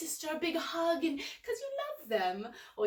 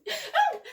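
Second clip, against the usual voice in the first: squeal; 14 semitones higher, 7 dB louder than usual. Shown as a demonstration. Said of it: "it makes us squeal".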